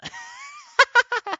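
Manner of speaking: high-pitched helpless laugh; high giggling fit; cracking up laughing. Real, not acted. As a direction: burst into squealing laughter after failing to keep a straight face. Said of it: "couldn't say that with a straight face".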